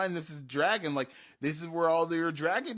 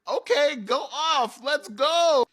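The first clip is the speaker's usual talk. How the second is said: hyped; 13 semitones higher, 12 dB louder, slower than usual. Retold or imitated. GM hypes up the players.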